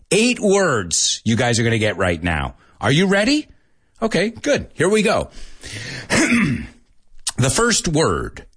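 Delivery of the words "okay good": ordinary talk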